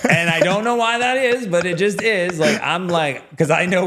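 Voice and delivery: words spilling out high through laughter; laughing while talking. Real, not acted. Still laughing as he teases Frank, high and loud. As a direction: keep laughing as you say it.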